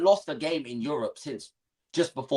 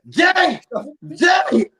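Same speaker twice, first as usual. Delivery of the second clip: very high mock yell; hammed-up shout imitating a worked-up friend; Jimmy; 13 semitones higher, 7 dB louder than usual. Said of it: "Jimmy be like".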